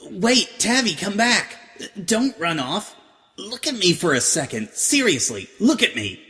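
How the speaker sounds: high and loud, pleading her friend to stay; Vinyl calling out. A narrator's reading. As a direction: call her back before she bolts. Vinyl calls after fleeing Octavia.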